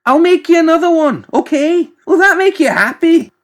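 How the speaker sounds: very high, loud fed-up outburst; at the end of his tether. Acted. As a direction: snap in exasperated surrender. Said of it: "at the end of his tether".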